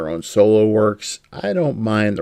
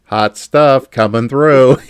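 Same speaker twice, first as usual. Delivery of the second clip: slow, louder catchphrase delivery; drawn-out cartoon catchphrase; mock hammed-up quote, slow and louder; quoting a cartoon; 3 semitones higher, 7 dB louder, slower than usual.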